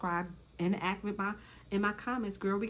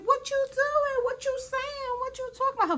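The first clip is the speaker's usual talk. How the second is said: mock Gretchen voice; 3 dB louder than usual. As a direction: stretch each question up high like a taunt. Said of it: "Her voice got real low".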